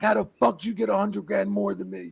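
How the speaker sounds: high-pitched retold outrage; fired up. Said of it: "he's fired up hard".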